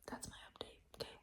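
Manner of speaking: hushed, breathy whisper with no voice; whispered update. Real, not acted. Late-night reading update to viewers, whispered. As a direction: whisper it so nobody wakes up.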